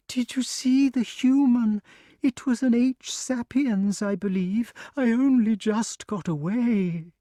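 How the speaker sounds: high, fluttery snake voice; a small creature still shaken from its escape; frightened grass snake. A narrator's reading. Grass snake tells Wart about the human, high and fluttery.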